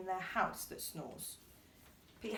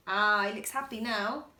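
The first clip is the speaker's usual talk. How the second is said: ordinary delivery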